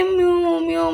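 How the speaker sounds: playful copy of a kitten's meow; imitating a kitten's meow. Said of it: "ये मुझे देख के".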